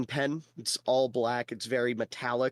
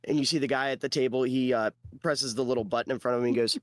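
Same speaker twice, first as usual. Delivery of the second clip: ordinary talk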